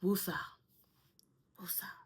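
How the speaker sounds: soft, fading calming breath word; calming woosah. Real, not acted. Calming herself down after the car trouble, soft woosah. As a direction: breathe it out to settle yourself. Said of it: "calming herself after stress".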